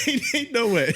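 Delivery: laughing echo. Real, not acted. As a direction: say it through a laugh. Echoing friend's phrase with laugh.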